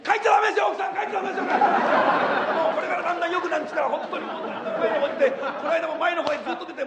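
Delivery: breathless hyper comedian routine with laughs; Sanpei impression. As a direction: rattle on like the frantic comic, shouting bits. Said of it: "三平の舞台を一つここでちょっとやってみましょう".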